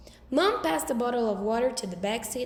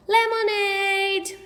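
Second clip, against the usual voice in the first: loud, slow, drawn-out call; 9 semitones higher, 6 dB louder, much slower than usual. A narrator's reading. Grandfather calling the family for lemonade, loud and slow. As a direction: call everyone over from the porch.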